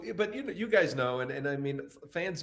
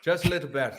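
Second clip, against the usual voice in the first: put-on light Irish accent; 2 semitones higher, 5 dB louder than usual. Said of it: "Pagan lets me do it".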